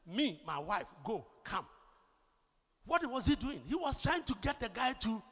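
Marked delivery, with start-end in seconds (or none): 0.1-1.7 word by word, with gaps; lightly mocked pidgin, lower pitch; imitated broken English. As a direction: imitate simplified English for a foreigner. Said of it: "you speak to somebody who speaks pidgin".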